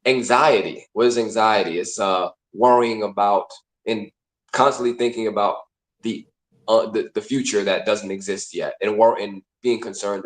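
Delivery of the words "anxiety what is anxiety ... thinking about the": normal talking voice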